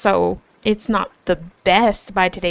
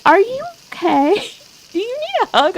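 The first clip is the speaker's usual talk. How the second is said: higher, gentle, worried; mom's concern; 12 semitones higher than usual. Retold or imitated. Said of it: "My mom was like".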